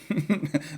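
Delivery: soft high giggle; sheepish amused chuckle. Real, not acted. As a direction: laugh quietly at your own accent. Laughs at own Irish attempt.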